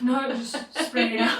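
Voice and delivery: ordinary delivery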